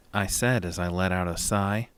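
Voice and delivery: plain reading voice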